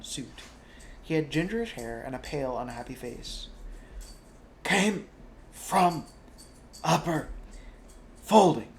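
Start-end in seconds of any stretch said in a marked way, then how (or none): 4.6-8.7 out of breath, gasping; panting. Acted. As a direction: gasp each word out. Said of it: "panted this dog man".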